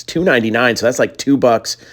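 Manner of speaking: ordinary talk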